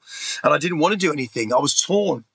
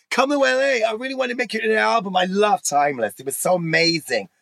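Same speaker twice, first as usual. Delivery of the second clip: lightly voiced gushing American star, quick at first; a hint of an excited pop diva pleading down the phone; 5 semitones higher than usual. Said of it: "And I had [name] on the phone saying".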